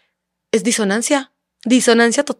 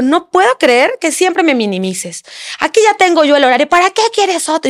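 mock angry husband; 7 semitones higher than usual. Wife imitating husband yelling about the schedule, mock indignant. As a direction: replay his accusation, pitched way up. Y empezó a gritar.

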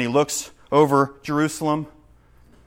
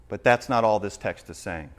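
ordinary delivery

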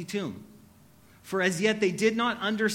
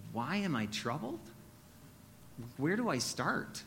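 soft, searching question to Jesus, much softer; hushed troubled prayer; 4 semitones lower, 10 dB quieter than usual. Pretend. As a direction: ask it quietly, as if overwhelmed. Preacher voices his own troubled prayer.

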